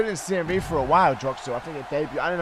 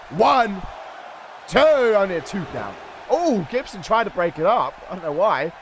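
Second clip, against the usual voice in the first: play-by-play; 5 semitones higher than usual. Acted. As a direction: call the match like a ringside announcer. Live wrestling play-by-play.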